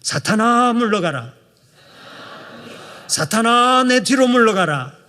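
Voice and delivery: loud commanding cry for the congregation; call and response shout. Shown as a demonstration. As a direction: lead the people in a shouted rebuke. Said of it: "한번 따라서 해봅시다".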